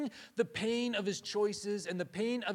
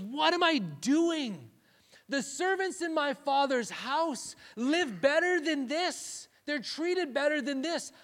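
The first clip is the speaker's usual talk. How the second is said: anguished self-reproach; 8 semitones higher, 6 dB louder, slower than usual. Retold or imitated. Prodigal son rehearsing his despair.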